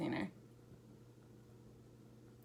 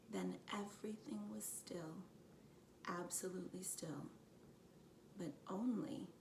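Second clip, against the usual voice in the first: hushed storytelling whisper; whispered narration; 9 dB quieter than usual. Acted. Reader closing the chapter to her geckos, whispering. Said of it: "reader drops to a whisper".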